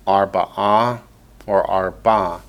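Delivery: ordinary talk, nothing marked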